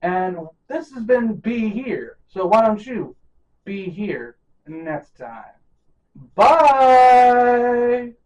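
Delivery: loud announcer outro; host booming the outro; podcast sign-off. Acted. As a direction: close the show like an emcee. Host's show sign-off.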